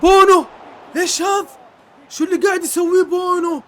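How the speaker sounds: high, loud shout of disbelief; amazed shout. Real, not acted. Streamer shouting in amazement at the keeper's save. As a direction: shout his name in amazement.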